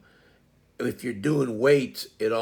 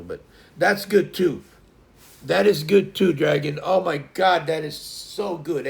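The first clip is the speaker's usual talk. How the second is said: normal talking voice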